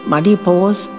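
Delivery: ordinary delivery, nothing marked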